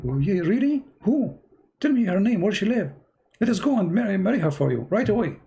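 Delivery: high, eager, delighted father voice; excited father. Retold or imitated. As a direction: play the thrilled dad bursting with joy.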